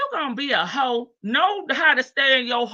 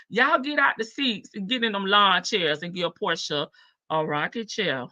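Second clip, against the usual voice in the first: ordinary delivery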